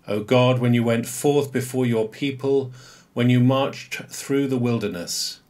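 measured, solemn reading of the psalm; liturgical psalm reading. Acted. Priest leading the congregation in the psalm, measured liturgical reading.

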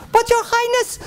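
very high, protesting lady voice; reluctant lady in waiting. Acted. Lady in waiting pleading with her highness, high voice. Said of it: "she said".

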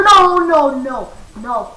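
real panic at the creeper; panicked shout. Real, not acted. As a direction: cry out as the creeper closes in.

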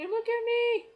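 high, loud showing-off; excited squeal. Retold or imitated. Fan having a picture taken at HMV, excited squeal. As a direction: squeal it for the camera. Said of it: "all excited".

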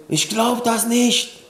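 nachgeahmte Fassungslosigkeit, hoch und gedehnt; ungläubiges Staunen. Retold or imitated. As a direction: mimic disbelief, slow and high. Gast wiederholt fassungslosen Ausruf. (fassungslos, hoch und langgezogen)

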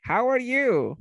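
high, lifted greeting to a whole room; class greeting. Shown as a demonstration. Teacher greets an imagined class, pitched high.

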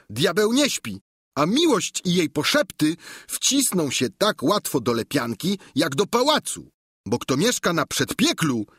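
a simple squire chattering cheerfully to his master; Sancho the squire. Acted.

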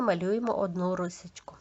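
usual speaking voice

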